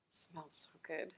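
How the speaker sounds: whisper. Real, not acted. Tasting the pasta.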